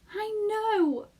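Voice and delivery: high excited cry; cried out. Acted. Arrietty thrilled at the plan, crying out. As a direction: burst out with joy. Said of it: "cried Arrietty".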